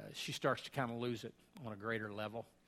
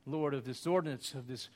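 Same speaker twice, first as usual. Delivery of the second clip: earnest, louder praying voice; communion prayer; 2 semitones higher, 5 dB louder than usual. Acted. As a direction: lift the words up to God in prayer.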